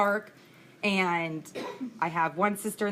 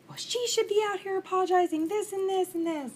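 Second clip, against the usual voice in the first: much higher, indignant whiny voice of a ranting stranger; mock angry lady; 10 semitones higher than usual. Woman in the parked car scolding the sisters, mocked high and whiny. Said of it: "the lady's going psycho on us".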